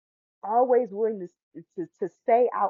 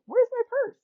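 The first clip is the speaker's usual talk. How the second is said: much higher, alarmed mom voice; alarmed stepmom; 3 dB louder than usual. Retold or imitated. Stepmom turning to the kids, much higher and alarmed. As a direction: play the stepmom suddenly noticing. Stepmom discovers missing purse.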